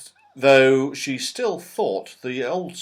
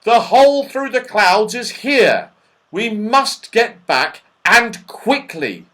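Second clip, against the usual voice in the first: loud, high, urgent insistence; despairing shout; 9 semitones higher, 8 dB louder than usual. A narrator's reading. Joe urges everyone back to the hole, desperately shouting. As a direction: press it home slowly and loudly. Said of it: "shouted Joe in despair".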